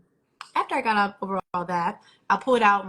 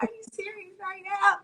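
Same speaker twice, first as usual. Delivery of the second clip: higher, incredulous "are you serious"; exasperated disbelief; 11 semitones higher than usual. Retold or imitated. Herself fed up with the broken TV, incredulous and high. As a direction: throw up your hands in disbelief.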